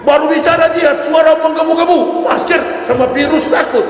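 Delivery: mock-roaring jibe at fiery preachers; mocking shout. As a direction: shout the hypocrisy back at them. Mocking fiery but virus-shy preachers.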